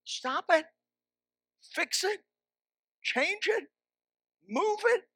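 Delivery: each short command hit high and sharp; punchy commands. Real, not acted. Preacher drilling the congregation, high punchy commands. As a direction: Stop it, Fix it, Change it, Move it, each punched up high. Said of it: "this is the principle".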